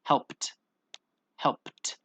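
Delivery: "Helped" with a puffed, separate final t; one syllable "Helped", ending in an aspirated t; breathed-out T ending. Shown as a demonstration. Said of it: "really breathe through that letter".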